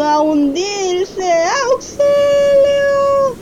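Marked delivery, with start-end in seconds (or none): 1.7-3.4 very high, slow, crying screams; higher drawn-out terrified calls; desperate cry for help. Acted. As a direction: shout as if sinking in the waves. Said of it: "Pedro sintió miedo, mucho miedo".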